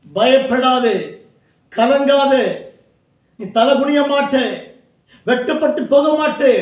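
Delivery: forceful, exhorting sermon voice; fervent preaching. Real, not acted. Preacher urging discouraged believers not to fear, fervent preaching. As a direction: preach with urgent, driving conviction.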